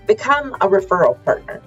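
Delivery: ordinary talk, nothing marked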